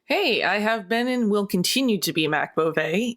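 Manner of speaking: ordinary talk, nothing marked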